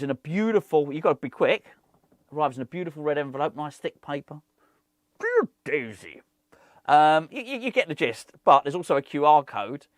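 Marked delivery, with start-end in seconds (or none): 5.2-6.2 slow, put-on Santa voice; drawn-out storybook greeting; Father Christmas. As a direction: read out a letter as Santa. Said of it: "a Father Christmas will read it".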